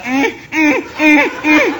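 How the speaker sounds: high, loud, strained stammering hums; stuttering hum. Acted. Mother-in-law stammers in high hums as she's carried off. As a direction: hum in jerky bursts as if stuck on a word. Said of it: "pero como tartamuda".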